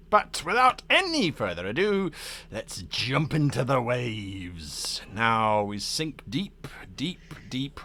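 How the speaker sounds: drawn-out, theatrical narration; game master scene setting. Acted. Game master launches the sea adventure, slow dramatic narration. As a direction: stretch each phrase, sinking into a whisper.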